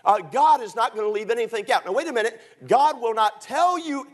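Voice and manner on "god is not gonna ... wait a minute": ordinary talk, nothing marked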